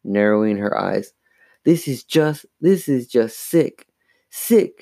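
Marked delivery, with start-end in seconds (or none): 1.7-4.8 higher pitch, rising on the repeat; exasperated disgust. A narrator's reading. Mom stares at the bedecked dummy, exasperated disgust. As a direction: build to a high, disgusted last word.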